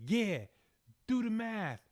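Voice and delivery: mock-irritated retort, pitched sharply up; retold pissed mother. Mother spelling it out to the teacher, mock pissed. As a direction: play the mom's impatient comeback, high. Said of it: "the mother's just pissed".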